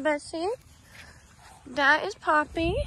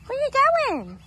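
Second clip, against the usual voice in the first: even higher, sing-song pet voice; 13 semitones higher, 5 dB louder than usual. Real, not acted. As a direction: coo after it, higher still. Talking to a chicken.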